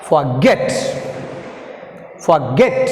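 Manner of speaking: "forget" with a forceful GET; for-GET, "forget" demonstrated slowly for learners; stress on second syllable. Shown as a demonstration. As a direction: hit GET hard in each "forget". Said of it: "Get is pronounced more forceful".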